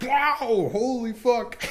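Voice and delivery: high, startled yelp at icy water; shocked gasp. Retold or imitated. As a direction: jolt up as if cold water hits you. Ice water thrown on face.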